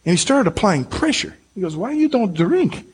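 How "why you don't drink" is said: put-on higher, slow muddled Mexican-Russian accent; badly imitated host's foreign accent. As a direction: attempt a Mexican accent and miss toward Russian. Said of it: "Was that Mexican or what was that? Russian?".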